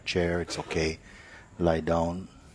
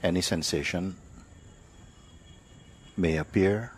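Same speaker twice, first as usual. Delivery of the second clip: guided meditation; 3 dB quieter than usual. Acted. Teacher leads sitters to notice body and breath, unhurried meditative delivery. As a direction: guide them gently inward, leaving silence between phrases.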